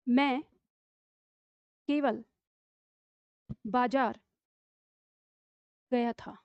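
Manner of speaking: slow, with long pauses between words; slow dictation. Shown as a demonstration. Teacher dictating the sentence slowly to pupils. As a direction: dictate word by word for the class to write. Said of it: "अब इसी में अगर हम इसको बदल दें".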